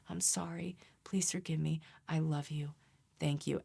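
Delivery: hushed, partly whispered mantra; soft mantra. Shown as a demonstration. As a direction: murmur it like a meditation. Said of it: "You can meditate on it".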